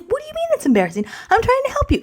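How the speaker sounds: squeaky-high imitation of an offended parent; defensive dad protest. Dad's hurt protest, acted.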